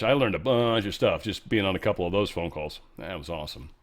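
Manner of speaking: ordinary talk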